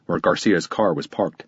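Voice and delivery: plain reading voice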